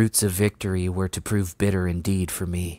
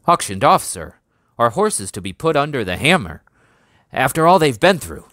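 higher and louder, only just holding his manners; barely polite; 6 semitones higher, 7 dB louder than usual. A narrator's reading. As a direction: keep it polite while outrage breaks through. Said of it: "The sergeant spoke politely, but only just".

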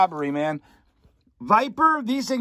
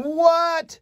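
surprised what; 9 semitones higher than usual. Real, not acted. Surprised at a comic's price.

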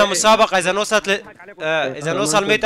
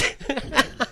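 laughing; 7 semitones higher, 6 dB quieter than usual. Real, not acted. Laughs after chaotic fight.

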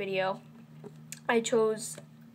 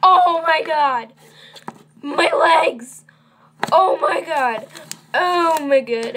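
high, loud hammed-up cries of pain; mock pained yelling; 5 semitones higher, 11 dB louder, slower than usual. Vlogger hamming up her mascot's broken legs, loud mock wails. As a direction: wail about broken legs in jest.

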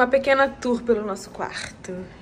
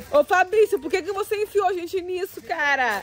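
scared complaint; 8 semitones higher than usual. Real, not acted. Scared on the trail, blaming Fabrício.

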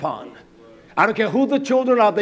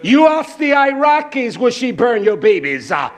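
high, raised, driving preacher's voice; impassioned preaching; 7 semitones higher, 5 dB louder than usual. Real, not acted. Preacher challenging congregation about America's bombings, impassioned preaching. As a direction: pound out challenge after challenge.

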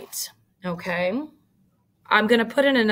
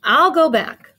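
higher, louder eager offer; 7 semitones higher, 8 dB louder than usual. Acted. Spike volunteering to fetch Bubba's shorts, higher eager voice. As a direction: jump in to help a friend. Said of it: "Spike volunteered".

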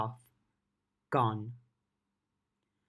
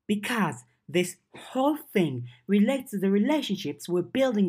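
put-on a lightly higher teen girl voice, defensive; lightly voiced young girl, stung and defensive; Verona voiced lightly; 5 semitones higher than usual. Verona pushing back at Lucy's suspicion, lightly voiced and defensive. Verona explains herself to Lucy.

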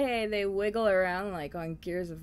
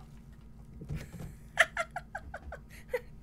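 high giggling laugh; 4 dB quieter than usual. Real, not acted. Dying in game, laughing.

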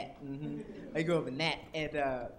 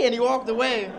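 usual speaking voice

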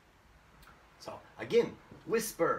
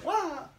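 high-pitched "wah!" of someone losing their footing; sudden high yelp; startled slipping cry. Shown as a demonstration. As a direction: yelp as if your feet just slid.

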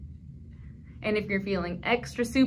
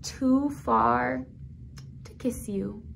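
slow story line fading to a hush on Tatita; storybook reading, grief gently; tender sad reading; 5 dB quieter, much slower than usual. Acted. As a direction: let the sadness settle, trail off.

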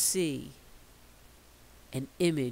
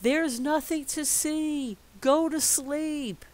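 exasperated order; 11 semitones higher, 5 dB louder than usual. Acted. Everyone orders the child.

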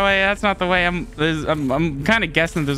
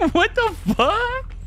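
incredulous; slower than usual. Real, not acted. Laughing disbelief.

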